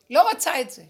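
a sudden loud לא רוצה את זה, hit hard; loud outburst on לא רוצה את זה; loud refusal. Real, not acted. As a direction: push it away with force. Refusing, pushing it away.

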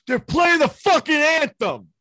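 loud, high, slow yelled line; mock indignant shout. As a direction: bellow it like an angry hockey player. Retelling Hanson shouting at referee.

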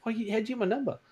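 high-pitched mock-angry questioning; retold indignant yelling. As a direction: fire questions in rising exasperation. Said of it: "then I started yelling at him".

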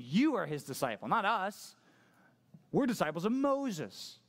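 high, slowing, self-important declaration; haughty Pharisee boast; puffed-up pride. Acted. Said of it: "They puffed their chest out".

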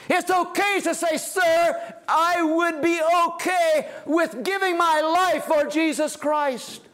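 rally the congregation with a shouted plea; preaching at full force. Acted.

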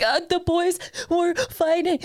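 high, slow, sobbing squeak; crying mess. Retold or imitated. Telling the cop what happened, high, slow mock sobbing. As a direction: choke it out through tears. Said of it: "a crying mess".

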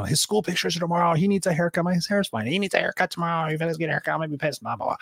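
mock nag about the haircut; imitating the wife. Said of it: "My wife was, like, down my neck".

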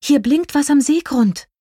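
high-pitched, eager exclamation; excited call. A narrator's reading. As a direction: call out your discovery excitedly. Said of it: "rief Prinzessin Lilifee aufgeregt".

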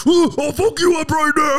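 very high-pitched imitation of a furious threat; comic retold rage, voice shot way up; mock furious. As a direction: squeal his empty threat, hammed up. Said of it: "like, furious while he's doing it".

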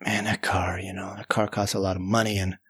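low, hushed regretful mutter; thinking aloud. Retold or imitated. Storyteller regretting the abandoned car, quiet inner thought. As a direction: murmur it to yourself, low and half-whispered. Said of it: "I'm thinking".

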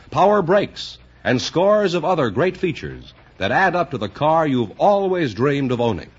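usual speaking voice

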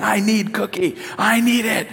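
high, insistent imitation of a toddler; mad little son. Dad mimics son who wants another cookie, mad toddler demand. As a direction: beg for it like a cross toddler. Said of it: "And he was mad".

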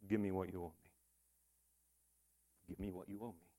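very soft, low voice, almost fading away; imagine God saying it, hushed and low; God's quiet demand. Acted. God to you on the last day, quietly demanding. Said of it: "Imagine if God said this to you".

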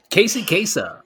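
high, loud radio host name-drop; Casey Kasem. Acted. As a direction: belt it out like a countdown host. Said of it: "Is it like the radio D Js".

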